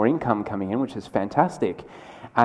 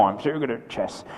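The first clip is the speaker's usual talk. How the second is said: softer, boastful mock French accent; lightly put-on French accent, smug chess champion; 6 dB quieter than usual. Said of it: "I can't really do the French accent very well".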